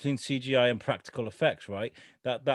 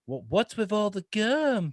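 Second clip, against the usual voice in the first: slow, higher put-on voice, drawn out; imitating how he talks; 8 semitones higher, slower than usual. Podcaster mimics a character asking about gum, slow and higher. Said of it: "'Cause that's how he talks".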